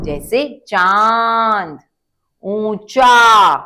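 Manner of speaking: चांद and ऊंचा stretched very slowly, nasal vowel drawn out; nasal example words. Shown as a demonstration. Teacher modelling चांद and ऊंचा slowly for children. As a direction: say चांद, then call out ऊंचा loud and very slow with a long nasal vowel. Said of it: "अनुनासिक शब्द".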